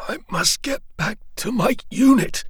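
a wounded soldier insisting on returning. Acted. Arthur pleads to return.

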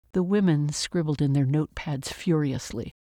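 usual speaking voice

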